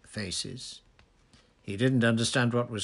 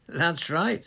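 loud, high, cheery confirmation; breezy agreement with a lifted, stronger voice; cheerful; 9 semitones higher, 6 dB louder than usual. A narrator's reading. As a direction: own up to it cheerfully. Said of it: "said Dumbledore cheerfully".

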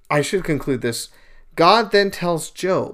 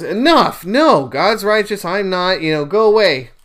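louder, higher, fed-up outburst; acted exasperation; 5 semitones higher, 5 dB louder than usual. Job finally answers his accusing friends, acted exasperation. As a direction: cut them off, acting fed up.

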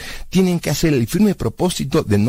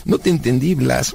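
ordinary delivery

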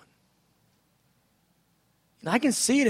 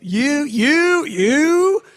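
ecstatic; 6 semitones higher, 7 dB louder than usual. Shown as a demonstration. Acting God's delight, ecstatic.

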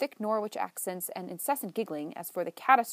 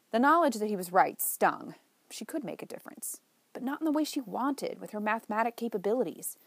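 usual speaking voice

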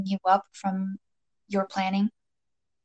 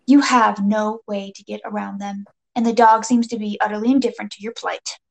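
usual speaking voice

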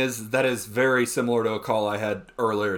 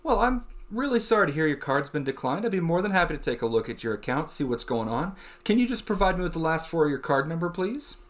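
polite, lilting call-centre patter; put-on professional phone voice; customer service voice; 3 semitones higher than usual. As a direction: play the apologetic, helpful support agent. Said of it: "so back to it".

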